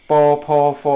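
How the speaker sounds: โป โพ โฟ held level and loud; initials drill. Shown as a demonstration. Teacher reciting sounds for learners, initials drill. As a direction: recite โป โพ โฟ evenly and loudly.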